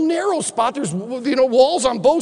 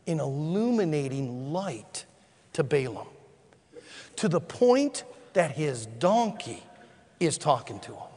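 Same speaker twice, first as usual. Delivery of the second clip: hushed solemn preaching; 11 semitones lower, 9 dB quieter than usual. Acted. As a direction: drop to an intense hush. Hushed solemn reading and comment.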